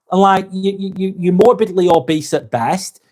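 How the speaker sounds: ordinary talk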